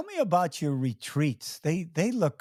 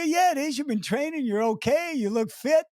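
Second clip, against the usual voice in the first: retold reassurance, higher; 10 semitones higher than usual. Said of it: "I go".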